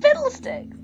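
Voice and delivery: high-pitched burst of mock exclamation; sudden mock outburst. Player mimics the game's sudden turn, mock outburst. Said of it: "suddenly it's like".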